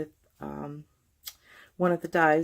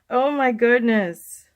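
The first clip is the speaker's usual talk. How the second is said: surprised; 6 semitones higher, 10 dB louder, slower than usual. Real, not acted. Stamp slips, startled reaction.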